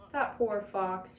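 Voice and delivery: ordinary delivery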